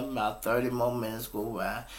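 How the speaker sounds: usual speaking voice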